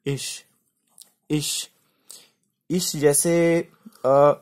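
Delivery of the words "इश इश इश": इश spoken with a short i before a soft sh; teacher drilling इश, ending in sh; say ish. Shown as a demonstration. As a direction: repeat इश as a careful model, i then sh. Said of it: "इसको बोलेंगे".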